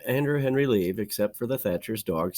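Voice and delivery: plain reading voice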